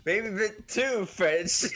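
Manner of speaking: amused. Real, not acted. Mock remark on French accent.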